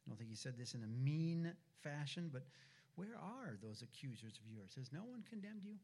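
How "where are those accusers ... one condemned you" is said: soft, gentle question without anger; gently ask, not accusing; quiet and gentle. Shown as a demonstration. Preacher voicing Jesus asking the woman, quiet and gentle. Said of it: "I think he was quiet. I think it was gentle".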